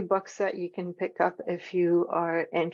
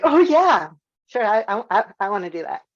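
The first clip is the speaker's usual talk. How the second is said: eager agreement; 2 semitones higher, 7 dB louder than usual. Acted. Presenter acting out welcoming a task, eager agreement. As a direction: jump at the offer, then ease off.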